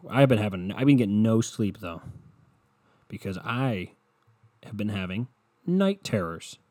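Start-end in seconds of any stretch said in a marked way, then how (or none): none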